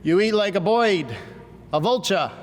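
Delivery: light Brooklyn grandfather joke; a hint of a Brooklyn accent. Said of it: "He used to joke in his Brooklyn accent".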